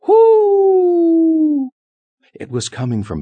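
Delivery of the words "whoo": long, piercingly high, loud "whoo"; very loud hoot. A narrator's reading. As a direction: hoot at full blast. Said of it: "The voice was louder still".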